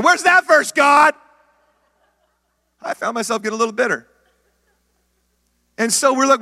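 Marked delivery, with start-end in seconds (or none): 0.0-1.1 high, loud frustrated shout at heaven; mock-indignant shout of a let-down believer; exasperated cry to God. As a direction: throw the question up at God, louder and higher. Preacher crying out at God.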